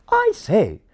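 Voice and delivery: high refined English voice; high, loud, refined English toff; refined English accent. Acted. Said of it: "with a particularly refined accent".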